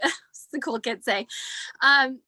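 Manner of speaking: ordinary talk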